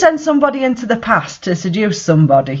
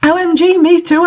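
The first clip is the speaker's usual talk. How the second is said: much higher and louder gushing; happy squeal; 11 semitones higher, 3 dB louder than usual. Acted. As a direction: squeal like a thrilled teen. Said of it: "I replied happily".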